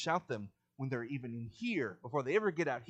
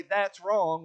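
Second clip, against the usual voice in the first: loud, higher-pitched call from the crowd; loud objection; 6 semitones higher, 11 dB louder than usual. Acted. Listener standing up against a speaker, loud objection. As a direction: say it out loudly as an objector. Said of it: "stand up and loudly say".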